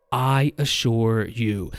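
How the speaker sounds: slow, low "I assure you"; weighty after pause. Acted. Voice actor imitating the character after a dramatic pause, slow and grave. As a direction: draw out each word, deep and deliberate.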